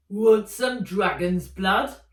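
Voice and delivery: slow, loud, gruff peddler; gruff old man. Acted. Old man pushing a vial at Nat, gruff. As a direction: lean in like a warty old hawker. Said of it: "said a gruff voice".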